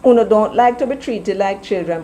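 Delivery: ordinary delivery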